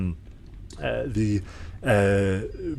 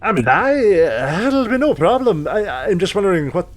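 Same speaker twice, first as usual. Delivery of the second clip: much higher, louder dwarf woman voice; dwarven smith; 6 semitones higher, 4 dB louder than usual. Acted. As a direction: greet the customer as a loud dwarven smith.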